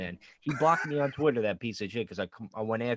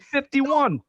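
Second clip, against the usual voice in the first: much higher and louder, cutting in to be heard; insistent loud correction; 13 semitones higher, 8 dB louder, slower than usual. Real, not acted. Correcting a friend who ignored him, loud and insistent. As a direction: shout the age over him.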